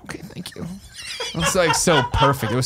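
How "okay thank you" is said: whispered, half asleep; groggy whisper. Retold or imitated. Woken passenger thanking the attendant, whispered groggily. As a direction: breathe it out sleepily.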